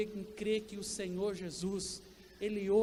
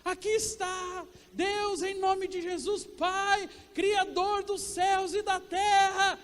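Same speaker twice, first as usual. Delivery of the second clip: higher and slowly swelling, a pompous prayer piling up titles for God; mock the overdone prayer, rising and getting louder; long-winded showy prayer; 8 semitones higher, 4 dB louder, slower than usual. Preacher mocking a wordy prayer, higher and building louder. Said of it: "eu ia ter que lembrar a Bíblia inteirinha pra Deus".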